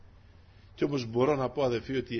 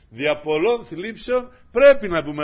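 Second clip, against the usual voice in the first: louder, slow, stress on πρέπει; loud proclamation; 5 semitones higher, 12 dB louder, slower than usual. Acted. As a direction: drive the verse home. Re-stating the teaching loudly. (δυνατά και αργά, τονίζοντας το πρέπει)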